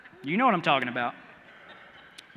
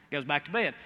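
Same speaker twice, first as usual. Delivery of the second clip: normal talking voice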